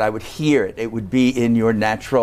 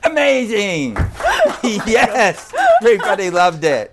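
loud delighted praise; excited cheer; 8 semitones higher, 5 dB louder than usual. Real, not acted. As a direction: cheer the singer on. Host cheers after song.

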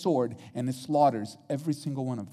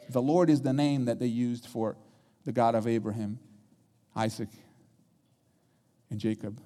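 ordinary talk

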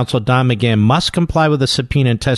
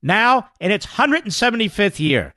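ad read; 5 semitones higher than usual. Acted. Sponsor read.

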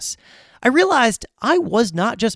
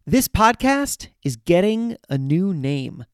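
ordinary talk

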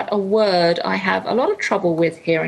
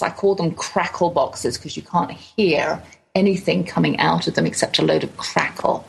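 ordinary talk

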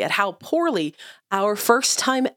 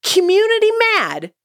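exasperated; 7 semitones higher than usual. Real, not acted. Venting frustration over cupcakes.

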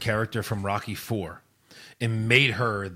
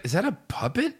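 mock puzzled; 7 semitones higher, 3 dB quieter than usual. Mimicking his puzzled reaction.

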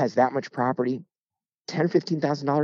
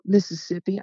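higher voice, lightly imitating a Southern girl; mimicked Southern girl; 9 semitones higher than usual. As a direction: answer as the girl, pitched up. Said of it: "cute little Southern accent".

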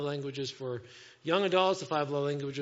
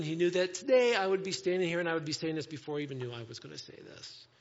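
ordinary talk

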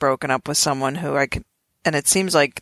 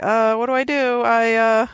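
flustered guy; 7 semitones higher than usual. Retold or imitated. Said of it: "he's like".

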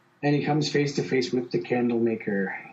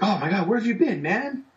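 excited greeting; 8 semitones higher than usual. Retold or imitated. Cliff greets returning friend Rebus.

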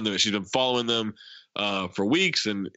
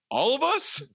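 high, disbelieving "All of us?"; mock shocked question; incredulous squeak; 12 semitones higher than usual.

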